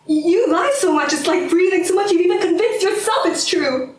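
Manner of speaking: high, forceful accusing outburst; angry tirade. Acted. Doubting partner accuses the other of constant lying, heated and high. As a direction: pour out the accusation with rising heat.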